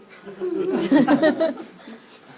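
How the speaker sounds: short, high, loud laugh. Real, not acted. Lecturer laughing at his own dark autopsy joke, loud laugh.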